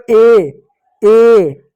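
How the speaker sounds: ए drawn out slowly and clearly; demonstrating ए. Shown as a demonstration. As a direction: model the vowel ए, held long. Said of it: "ए का उच्चारण". (ए का लंबा, साफ़ उच्चारण)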